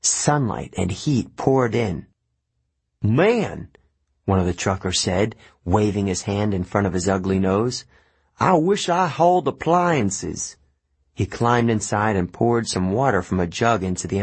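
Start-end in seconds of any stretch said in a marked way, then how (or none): 3.0-3.7 a griping trucker; coarse trucker voice. A narrator's reading. Trucker complaining about the smell, griping. 8.4-10.6 a griping trucker; coarse trucker voice. A narrator's reading. Trucker complaining about the smell, griping.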